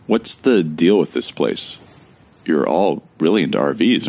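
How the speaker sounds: ordinary delivery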